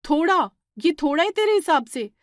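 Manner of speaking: furious sister-in-law; angry disbelief. Acted. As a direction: throw the word back at her in anger. Divya scolds Shweta over dough.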